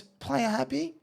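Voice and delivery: very high-pitched coach impression; high mock-coach questioning; imitating the coach. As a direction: squeak out an interrogation as the coach. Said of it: "he goes".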